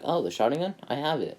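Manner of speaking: high, proud kid voice, lightly voiced; light mock smug boy; Naruto's reveal. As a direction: lightly act a cocky kid showing off. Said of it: "Naruto goes like".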